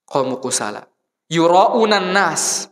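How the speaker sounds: slow, loud Quranic recitation. Acted. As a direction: draw the verse out slowly.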